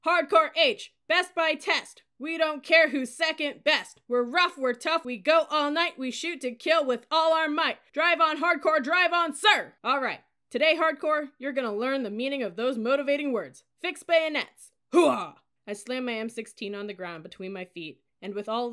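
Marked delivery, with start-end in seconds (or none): 0.1-9.8 high, loud company chant; shouted motto. A narrator's reading. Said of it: "collective breath before we shouted". 9.8-15.4 high, very loud military orders; shouted drill commands. A narrator's reading. Drill leader commanding cadets, cadets answering.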